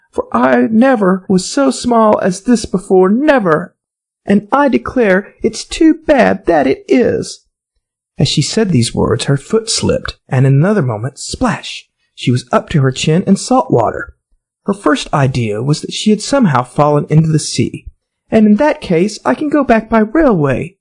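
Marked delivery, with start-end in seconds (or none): 0.1-7.5 higher, lightly voiced girl; a young girl fretting, lightly played; Alice's voice. A narrator's reading. Alice's voice in story. 11.2-11.9 breathy unvoiced splash noise; whispered splash. A narrator's reading. Narrated splash sound. 18.3-20.8 higher, lightly voiced girl; a young girl fretting, lightly played; Alice's voice. A narrator's reading. Alice's voice in story.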